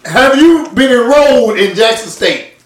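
cross-examining a dodging friend; pressing interrogation. Real, not acted. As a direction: demand a straight answer.

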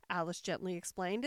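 plain reading voice

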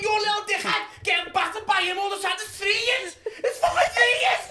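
sustained high, loud ranting; exasperated yelling. Acted. Dad telling kids to fight back, screaming. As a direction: rant at screaming pitch without letting up.

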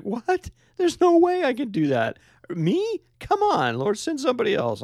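very high-pitched, disbelieving Moses impression; hammed-up, high-pitched reluctant balking; mock incredulous protest. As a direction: squeal in exaggerated disbelief, pleading to be let off. Acts out Moses balking at God's call.

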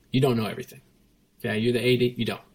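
usual speaking voice

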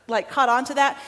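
ordinary talk, nothing marked

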